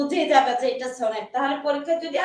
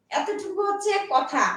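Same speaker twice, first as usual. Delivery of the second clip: ordinary delivery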